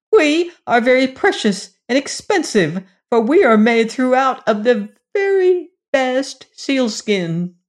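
proud little creatures announcing themselves; sealskin dwarfs. Acted. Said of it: "said the brown people".

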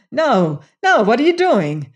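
high, loud furious protest; furious demand. A narrator's reading. Venters protesting to Lassiter, high and furious. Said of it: "he demanded in a kind of fury".

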